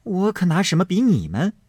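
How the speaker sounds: a lightly acted jeer ending in a spit; mocking girl. A narrator's reading. Fangguan mocks back, ends spitting. (尖酸挖苦的小丫头)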